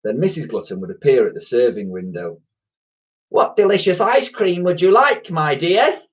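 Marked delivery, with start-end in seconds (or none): 3.3-6.0 high, loud, sugary saleswoman; sweetly put-on high voice; nice voice. A narrator's reading. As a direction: lay on the niceness for a young customer. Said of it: "in her nice voice".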